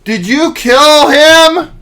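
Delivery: high, loud, slow mock yell; hammed-up accusation. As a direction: stretch out an overacted, outraged question. Mimicking actor's overwrought confrontation.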